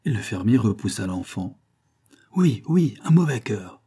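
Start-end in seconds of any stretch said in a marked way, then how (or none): 2.4-3.8 high, loud reproachful cry; tearful yell. A narrator's reading. Pitou backs the farmer against Sébastien, yelling reproachfully. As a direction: yell it, a touch of tears implied. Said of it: "hurla Pitou, fondant en larmes".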